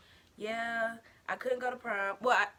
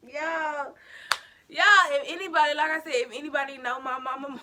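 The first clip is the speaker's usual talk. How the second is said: excited call-out; 7 semitones higher, 12 dB louder than usual. Real, not acted. Hyping up crazy mama.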